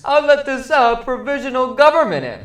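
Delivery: high, loud, hammed-up royal voice; mock Tsar voice. Podcast host mocking the Tsar in a high, loud royal voice. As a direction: play the abdicating monarch, pompous and silly. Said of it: "She was like".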